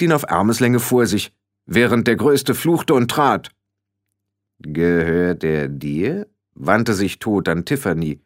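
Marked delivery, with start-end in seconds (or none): 4.6-6.3 slow, low, ponderous voice; weighty low question, slowly rolled out; heavy thunderous Death. A narrator's reading. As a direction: speak as Death, drawn out and deep. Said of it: "Die Stimme war schwer und erklang um sie herum wie Donner".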